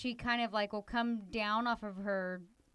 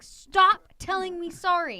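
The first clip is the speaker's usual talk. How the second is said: loud, higher, slow stop telling me sorry; exasperated mom scolding her daughter; irritated retort; 7 semitones higher, 11 dB louder, slower than usual. Retold or imitated. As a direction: snap it out, fed up with the apologies. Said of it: "And I'm like".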